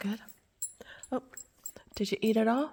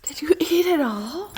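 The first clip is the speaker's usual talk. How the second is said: high pet voice; 6 semitones higher, 3 dB louder than usual. Real, not acted. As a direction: lift it up like talking to a puppy. Praising dog, pet talk.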